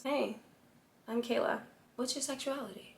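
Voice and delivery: soft, unhurried introduction with pauses; appealing flirty tone. Shown as a demonstration. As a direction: introduce yourself quietly, letting it breathe. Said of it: "Which one did you find more appealing".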